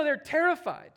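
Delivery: ordinary talk, nothing marked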